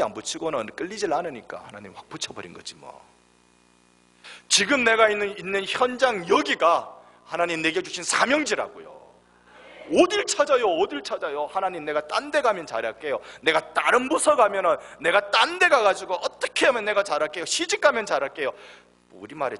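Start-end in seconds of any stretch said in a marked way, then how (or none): 4.5-11.4 loud, high preacher's call; fervent preaching. Acted. Pastor urging the congregation to stay, loud high preaching. 11.4-18.5 mock excuse prayer. Mocking believer's excuse prayer.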